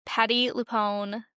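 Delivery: ordinary talk, nothing marked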